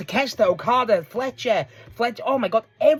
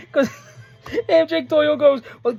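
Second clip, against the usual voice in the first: normal talking voice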